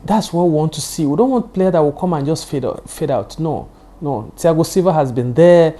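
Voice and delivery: ordinary talk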